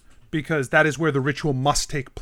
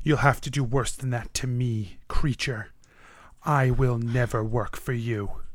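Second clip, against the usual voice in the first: lower, softer contempt for the creature; quiet defiance; 4 semitones lower, 6 dB quieter than usual. Acted. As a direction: refuse calmly, giving nothing. Iron Shaper defies again.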